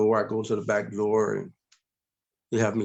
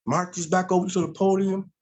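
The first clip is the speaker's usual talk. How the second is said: ordinary talk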